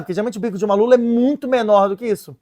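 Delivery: usual speaking voice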